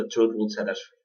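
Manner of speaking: ordinary talk, nothing marked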